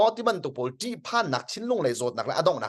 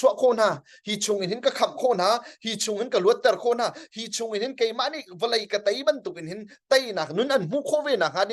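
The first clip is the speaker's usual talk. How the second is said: preaching higher, pressing on with fervour; impassioned sermon climax; fervent preaching; 7 semitones higher, much faster than usual. Acted. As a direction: lift the voice and preach it home.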